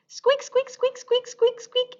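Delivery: high squeaky mouse noises; mouse squeaks. Acted.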